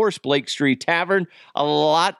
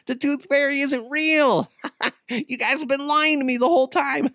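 high-pitched mock laughter through the words; giggly, high imitation of a boy; imitating laughing son; 11 semitones higher than usual. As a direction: act out a kid cracking up while talking. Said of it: "he starts laughing. He's all".

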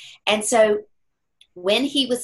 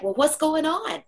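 gentle asking; 10 semitones higher than usual. Retold or imitated. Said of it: "and I'm like".

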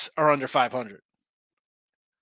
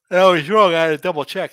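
words stretched through a yawn; 4 semitones higher, 9 dB louder than usual. Real, not acted. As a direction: talk while yawning. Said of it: "Excuse me as I yawn".

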